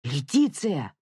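brief admonishing call; stern husband cutting in; sharp reprimand. A narrator's reading. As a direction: call the name to check someone. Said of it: "прикрикнул на неё Давид".

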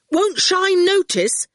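high, slow, carrying question; calling out. A narrator's reading. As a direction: call it across to someone. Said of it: "Howard called".

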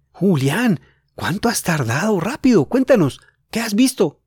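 mild mock yell, eager; eager shout. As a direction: hurry him with impatient excitement. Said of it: "gritó Jorge".

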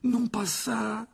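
high, very slow plea; feeble protest. A narrator's reading. Harry dreading Lockhart's wand, feeble protest. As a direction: draw out a weak, high plea. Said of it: "protesta faiblement Harry".

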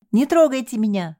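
high wailing plea; wailing cry. Acted. Девушка кричит спасателям не трогать её, высокий вопль. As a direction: wail it, pushing them away. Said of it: "заголосила она".

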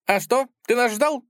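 high, perky character voice; pert little figure. A narrator's reading. Little Tik to the narrator, perky high voice. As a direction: pipe it up high, questioning.

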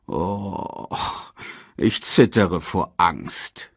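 slow, unimpressed mock fear; calm sarcasm. A narrator's reading. Scar brushes off the herald's warning, slow calm sarcasm. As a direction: feign fear slowly and calmly. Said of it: "sagte Scar ruhig".

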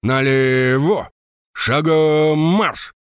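slow, loud drawn-out drill commands; shouting drill sergeant. A narrator's reading. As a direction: bellow the commands across the yard.

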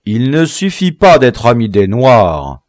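forceful reprimand; stern. A narrator's reading. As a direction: correct the prisoner severely. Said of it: "repartit sévèrement Biassou".

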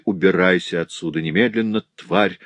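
plain reading voice

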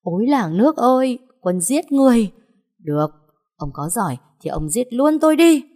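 high, loud hysterical hollering; wailing shout. Acted. Slapped wife crying murder to the neighbours, shrieking. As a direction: scream for the whole village to hear. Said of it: "lô loa lên".

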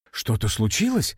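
plain reading voice

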